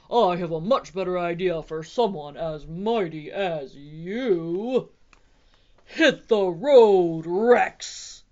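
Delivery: loud, booming put-on voice; big tough dinosaur. A narrator's reading. Bob faces down the T-Rex, booming defiance. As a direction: build to a slow, bellowed send-off.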